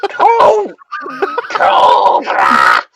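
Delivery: high screeching nasal villain cry; Cobra Commander screech. Retold or imitated. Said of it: "screeching, nasally quality".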